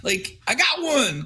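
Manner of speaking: high, excited call to a friend; bursting to share the news; stoked. Retold or imitated. Calling out to Derek about a new buyer, excited and high. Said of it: "I was stoked".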